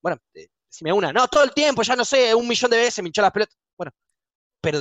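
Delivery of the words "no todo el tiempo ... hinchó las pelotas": high, hurried grumbling; exasperated rant. Acted. Professor fed up with being pestered, high exasperated rant. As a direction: complain as if at the end of patience.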